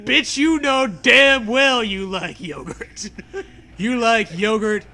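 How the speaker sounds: ordinary delivery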